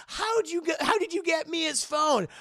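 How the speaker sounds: high loud panic; alarmed high demand. Acted. Anna panicking at the villain, high and loud.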